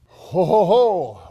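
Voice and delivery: booming Santa laugh. Acted.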